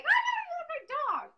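very high, hammed-up squeal; frantic dog lady. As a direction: play a dog-obsessed lady in a tizzy. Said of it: "this middle-aged lady with the dogs".

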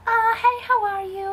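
high, sweet phone greeting; friendly sing-out, pitched well up; imitating nice caller. Woman on the other end of the phone, imitated nice greeting. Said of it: "she was so nice".

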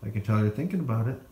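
ordinary delivery

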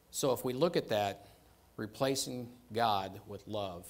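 normal talking voice

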